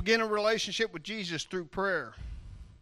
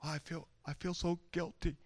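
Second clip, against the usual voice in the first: softer, a touch of hammed-up guilt; mock guilt; 7 dB quieter than usual. A sinner avoiding God, mildly mock guilty. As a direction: mope it out half-seriously.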